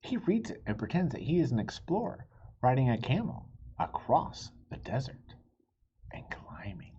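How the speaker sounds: gentle storybook reading voice; story narration. A narrator's reading. Reading a picture book to young listeners, gentle narration. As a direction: read it like bedtime story time.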